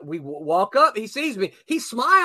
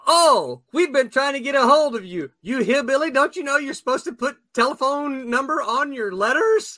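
higher, louder mock scolding on the phone; imitating the boss calling him a hillbilly; Tom's gruff phone greeting; 8 semitones higher, 3 dB louder than usual. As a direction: bark it like an irritated boss. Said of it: "almost the first words out of his mouth".

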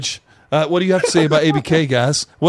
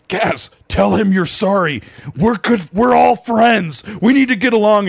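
pleading peacemaker; 6 semitones higher than usual. Retold or imitated. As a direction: coax him to say sorry. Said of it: "I'm like".

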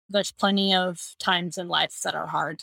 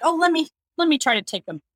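higher, hurried offer of help; quick, higher-pitched retold friend jumping in; eager offer; 7 semitones higher, 3 dB louder, faster than usual. Friend offers to take crying babies.

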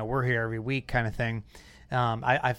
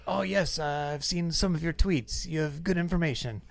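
put-on Andretti's Italian accent; 5 semitones higher, 3 dB quieter than usual. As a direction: imitate an elderly racer's measured Italian accent. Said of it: "that's a great Italian accent".

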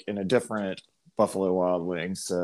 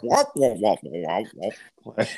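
muffled trombone-like babble; Peanuts teacher voice; slower than usual. Retold or imitated. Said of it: "Peanuts, like, teacher".

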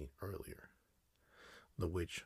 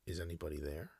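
ordinary delivery

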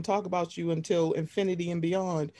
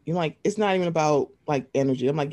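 normal talking voice

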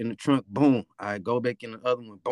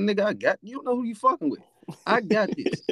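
higher, mock-cocky boast; 9 semitones higher, faster than usual. To his dad, a cocky high brag. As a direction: brag like a teen who knows it all. Young self boasting, retold.

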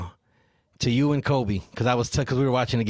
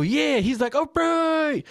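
put-on child voice; 14 semitones higher, much slower than usual. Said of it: "And I go".

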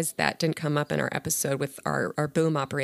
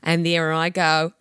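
put-on slower, louder Australian accent; Australian accent, savoured; 5 dB louder, slower than usual. Host repeating her line, slower and louder Australian accent. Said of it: "I feel so silly even trying".

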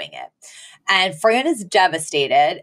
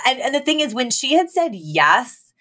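ordinary talk

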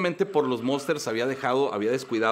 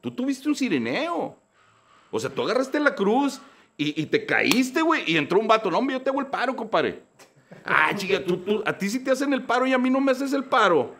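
higher, louder exasperated rant at God; telling God off; 9 semitones higher, 4 dB louder than usual. Retold or imitated. Speaker complaining to God that others get help, indignant rant. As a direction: demand answers from God, fed up. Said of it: "le digo".

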